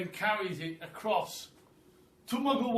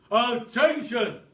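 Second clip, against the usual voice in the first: loud, slow barked command; fearsome monkey trainer; 7 semitones higher, 12 dB louder, slower than usual. Acted. Mister Twit barks at the caged monkeys, fearsome trainer voice. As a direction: bark it like a drill sergeant. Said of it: "in a really fearsome monkey trainer's kind of voice".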